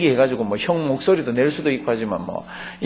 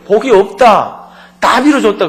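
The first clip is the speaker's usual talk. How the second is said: put-on old father refusing; 7 semitones higher, 6 dB louder than usual. Preacher voices old Isaac refusing.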